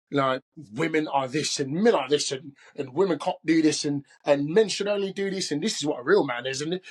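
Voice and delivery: imitated London street accent; amateur British accent, roughly London. Shown as a demonstration. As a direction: talk to your daughter in a put-on London accent. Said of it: "probably sounds like some part of London".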